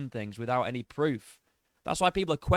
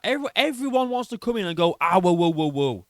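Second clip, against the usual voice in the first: exaggerated mockery; 5 dB louder than usual. Reactor repeating what everyone wants to do, loudly mocking. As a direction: parody it with a loud wooing burst.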